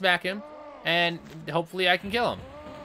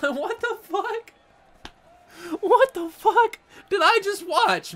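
high, slow mock-stunned voice; acted disbelief; 10 semitones higher, slower than usual. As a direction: play a flabbergasted onlooker, drawn out and high. Acts stunned witness to death.

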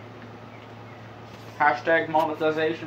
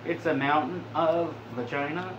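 normal talking voice

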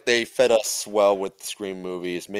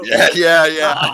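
much higher and louder, laughing agreement; excited yes; 7 semitones higher, 11 dB louder, slower than usual. Real, not acted. Thrilled that Volcano came up, loud laughing yeses. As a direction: burst out agreeing.

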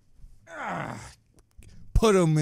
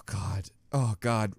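usual speaking voice

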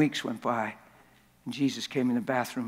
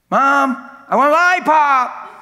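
put-on much higher, loud little kid's voice; child asking; 12 semitones higher, 13 dB louder than usual. Little kid asking mom for a lollipop, high and loud. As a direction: whine it up like a small child.

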